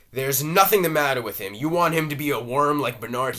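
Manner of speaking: Willie exploding at his wife in anger. A narrator's reading. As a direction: burst out at her, furious and loud. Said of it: "exploding at her".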